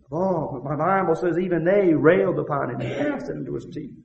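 high, loud, fervent preacher's cry; full-force preaching. Acted. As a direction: preach it out at the top of your voice.

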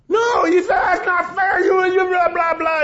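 high-pitched quarrelsome protest; mock indignant retort. Spouse blamed wrongly argues back, mock indignant. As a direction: argue back in a hammed-up high voice, running into blahs.

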